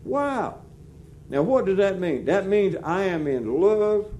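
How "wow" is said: hushed, then lifted, amazed wow; a soft, high, lovestruck wow; awed wow. Retold or imitated. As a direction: breathe it out in quiet wonder. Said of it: "looking at her at lunch a while ago thinking".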